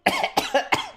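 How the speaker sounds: high strained acted cough; dwarf cough. Dying dwarf coughing after his outburst.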